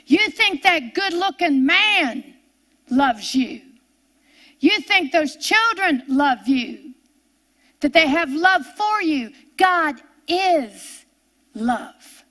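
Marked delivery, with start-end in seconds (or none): none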